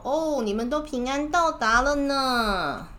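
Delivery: high, slow welcoming grandpa. Pretend. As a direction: greet the arriving children kindly. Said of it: "外公就说".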